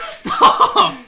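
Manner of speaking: high squeal through laughter; laughing shout. Real, not acted. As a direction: yelp it while cracking up. Cries out while laughing hard.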